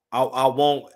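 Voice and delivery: ordinary talk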